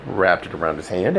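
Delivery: plain reading voice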